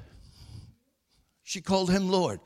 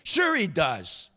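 raised, forceful preacher voice; emphatic preaching; 4 semitones higher, 4 dB louder than usual. Real, not acted. Preacher assures congregation Jesus knows their days, forceful preaching. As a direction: drive each point home to the congregation.

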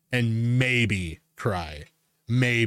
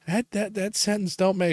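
higher-pitched imitation of a stammering actor; mimicked tongue-tied stammer; flustered stutter; 7 semitones higher than usual. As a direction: stumble over the first word, thrown off. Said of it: "she's trying to stutter her words".

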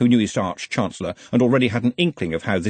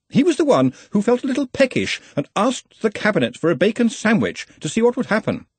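high-pitched, insistent subordinate; earnest, fussy assistant; 13 semitones higher than usual. Acted. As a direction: remind your superior of the rules in a higher voice. Ponder explains the case.

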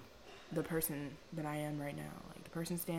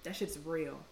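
usual speaking voice

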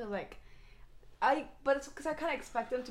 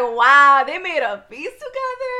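mock amazement; 2 semitones higher, 12 dB louder, slower than usual. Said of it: "I was like".

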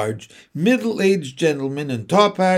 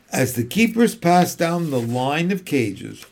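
plain reading voice

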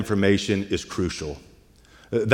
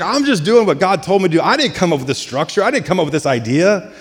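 higher-pitched defensive protest; mock protest; 7 semitones higher, faster than usual. Aaron answers those grumbling against him, protesting innocence. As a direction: plead innocence, pitch lifted.

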